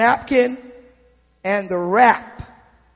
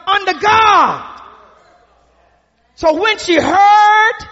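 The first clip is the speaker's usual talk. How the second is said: rising, louder preacher's cadence; preaching at full force; 6 semitones higher, 5 dB louder than usual. Acted. Preacher to the congregation, rising loud cadence. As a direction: build it up from the pulpit.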